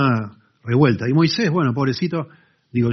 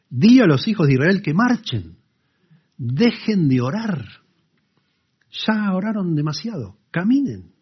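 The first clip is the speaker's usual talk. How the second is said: commanding God; 7 semitones higher, 3 dB louder than usual. Retold or imitated. God orders Israel to march.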